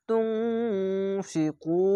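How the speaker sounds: তুনফিকু with nasal ghunna and the ku stretched; Quranic recitation. Shown as a demonstration. Teacher recites the word for students, drawn out. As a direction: recite তুনফিকু slowly, drawing out each sound. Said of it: "ইকফা গুণনা".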